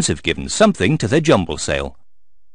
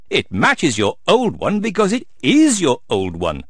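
usual speaking voice

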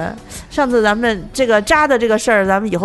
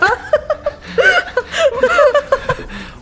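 high, long laughter; laughing fit. Real, not acted. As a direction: laugh until breathless.

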